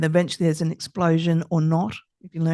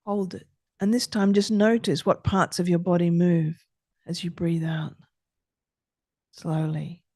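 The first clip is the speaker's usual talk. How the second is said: guided breathing. Acted. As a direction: talk the listener through each breath, gently. Leads a breathing exercise.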